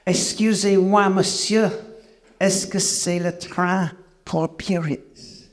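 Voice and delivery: high, slow, exaggerated French accent; best French accent. Repeating the question to a blank-faced Frenchman, best French accent. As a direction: lay on your best French accent, drawn out. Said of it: "say it again in my best accent".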